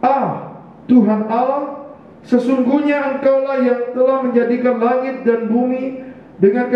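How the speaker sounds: high, slow solemn reading; scripture reading. Acted. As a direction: proclaim the verse slowly. Reads the scripture verse.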